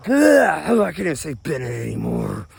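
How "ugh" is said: loud, high groan of frustration; frustrated groan. Real, not acted. Speaker fumbling the name Bennett, loud exasperated groan. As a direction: groan loudly at your own slip.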